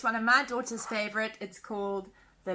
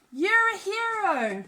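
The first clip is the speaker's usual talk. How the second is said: high, louder praise; father dragon cheering his son, higher and louder; proud cheer; 8 semitones higher, 4 dB louder, slower than usual. Acted. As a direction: hail him loudly as a hero. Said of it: "Mister Dragon cried".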